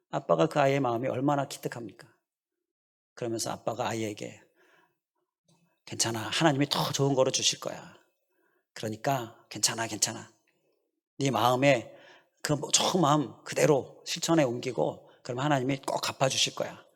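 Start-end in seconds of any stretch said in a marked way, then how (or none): 5.9-16.8 put-on a father hushing his crying child; softer, gentle reassurance; reassuring dad. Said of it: "이렇게 아이를 격려했어요".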